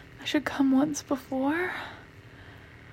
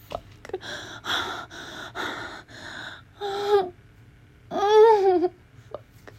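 loud high breathless moans; real pleasure moans, rising in intensity; moaning; 6 semitones higher, 3 dB louder, much slower than usual. Real, not acted. Building climax moans.

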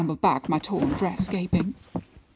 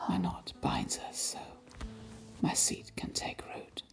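whispered; 12 dB quieter than usual. Acted. Intimate whisper to her.

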